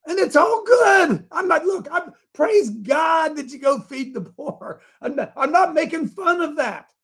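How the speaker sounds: high, loud preaching shout; fired-up preaching. Real, not acted. Pastor firing up his men, shouting. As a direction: preach it hard, caught up in the fire.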